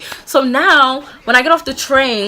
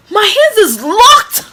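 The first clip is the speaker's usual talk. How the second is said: high, slow drawn-out realization; dramatized alarm; shocked disbelief; 6 semitones higher, 5 dB louder, slower than usual. Retold or imitated. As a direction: stretch it out, stunned and indignant. Acts out shock at being cuffed.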